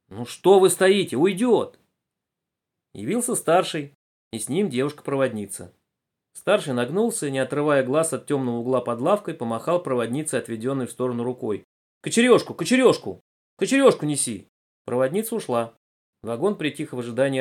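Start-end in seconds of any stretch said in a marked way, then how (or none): 0.1-1.8 loud, high, drawn-out urging; urgent prodding. Acted. Passenger urges men standing over the viper, urgently. 12.0-14.5 loud, raised repeated command; urgent order. Acted. Senior conductor sends conductress for poker, urgently ordering.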